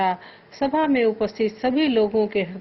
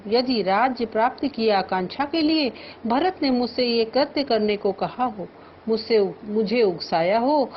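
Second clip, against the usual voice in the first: normal talking voice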